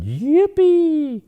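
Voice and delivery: high squealed yippee; child's joyful cry; excited shout. A narrator's reading. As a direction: shriek it with glee. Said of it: "she shouted".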